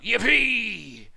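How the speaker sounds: loud, high cheer; crying out. A narrator's reading. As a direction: whoop it out in triumph. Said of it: "the T's cried out".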